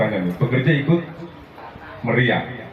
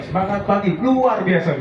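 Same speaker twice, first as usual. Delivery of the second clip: higher cheer call; demo cheer; 7 semitones higher than usual. Shown as a demonstration. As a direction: try it out for them. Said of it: "Mas saya coba".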